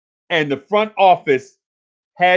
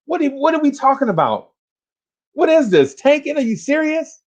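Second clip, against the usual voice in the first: exasperated outburst; 10 semitones higher than usual. Real, not acted. Ranting about tanking talk.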